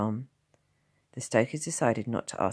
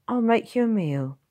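motherly village wife; 11 semitones higher, 8 dB louder than usual. A narrator's reading. Blacksmith's wife voiced.

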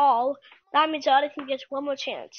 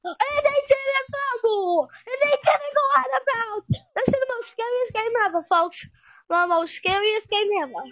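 mock play-by-play; 5 semitones higher than usual. Calls the game like an announcer.

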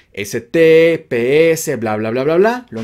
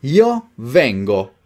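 slow, each word set apart; slow modelling; slower than usual. Shown as a demonstration. Teacher gives learners the conjugation to repeat, slowly.

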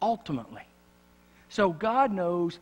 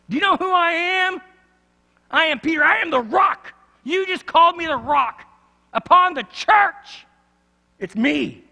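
shouted high and loud, full of himself; arrogant boast; 12 semitones higher, 10 dB louder than usual. Retold or imitated. Peter boasting to Jesus that he is the rock, shouting high and loud. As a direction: belt out the boast as a cocky Peter. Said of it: "I'm sure Peter thought".

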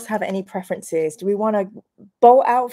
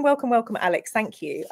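ordinary talk, nothing marked

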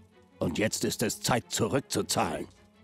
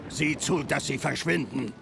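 angry; 8 semitones higher than usual. Acted. Orders them away.

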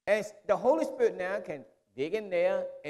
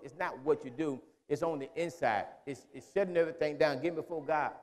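normal talking voice

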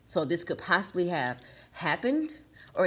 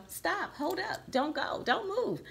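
high, soft pretend pleading to stay; imitated plea; 11 semitones higher, 4 dB quieter, slower than usual. Reader voicing the feminine card halting her partner, high imitated plea. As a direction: act out begging someone to wait, pitch climbing. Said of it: "it looks like she's saying".

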